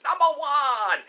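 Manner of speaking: loud, high, drawn-out cheer; hammed-up shout. Streamer hailing Eddie's Funhouse, hammed-up shout. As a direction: call it out big and slow. Calling out a number one chant.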